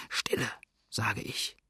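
slow, hushed command for silence; whispered order. A narrator's reading. Steersman interrupting the nervous bowman, slow whisper. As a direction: whisper it slowly to silence a crewmate.

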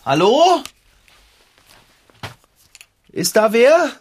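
nervous loud call into the dark. Acted. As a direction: call out, high and unsure. Calling into dark shop.

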